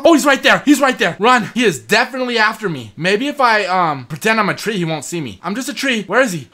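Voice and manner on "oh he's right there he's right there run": higher, louder alarm; sudden higher urgent alarm. Real, not acted. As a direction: warn yourself to flee. Slender Man appears.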